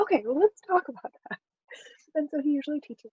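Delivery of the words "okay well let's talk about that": a bit higher and louder, host voice; imitating a TV host, lightly voiced; mock TV host. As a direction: play the patient makeover host. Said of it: "He's like".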